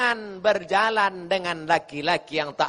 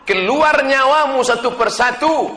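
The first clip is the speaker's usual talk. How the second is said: high, loud, emphatic defiance; impassioned declaration; 8 semitones higher, 8 dB louder than usual. Acted. Companion defies his mother's hunger threat, loud impassioned voice. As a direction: ring it out with full force.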